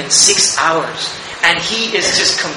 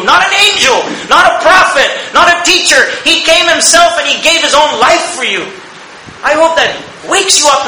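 rising, louder preacher's climax, shouting the list; fiery preaching; 5 semitones higher, 3 dB louder than usual. Acted. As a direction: preach it hard to the congregation. Preaching at full force.

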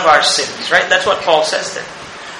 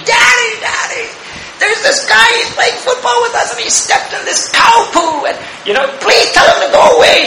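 put-on high, giggling child's voice, half-shouting; disgusted little daughter. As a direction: play the daughter squealing in disgust. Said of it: "She was so disgusted".

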